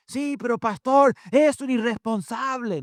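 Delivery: high-pitched, loud parental complaint; indignant parent's protest, pitched high. Acted. Acts out a parent's objection.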